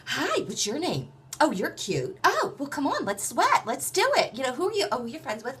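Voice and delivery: high, excited, gushing welcome; Richard. Retold or imitated. Richard welcoming a new face at his gym, effusive greeting. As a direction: greet them in an eager high-pitched rush of delight. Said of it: "it's a huge greeting".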